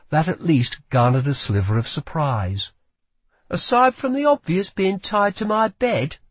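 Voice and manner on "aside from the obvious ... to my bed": put-on higher, sharp girl voice; young woman voice. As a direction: voice a wary young thief. Lila teases her captive.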